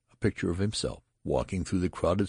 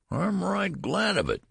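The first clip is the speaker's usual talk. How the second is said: much higher, thin whine; whining old man; 4 dB louder than usual. A narrator's reading. Old man grumbling to the nurse, high whine. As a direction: whine like a grumpy old baby. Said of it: "whined the old man".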